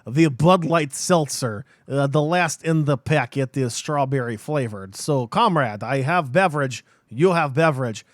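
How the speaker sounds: loud mock Soviet comrade with Russian accent. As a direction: put on a hammed-up Russian accent, slowing at first. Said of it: "You had some good Russian there".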